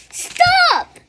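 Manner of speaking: very high, loud panicked shriek; scream. A narrator's reading. Wilbur begging for his life, a shrieking stop. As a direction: shriek it in terror. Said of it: "screamed the Wilbur".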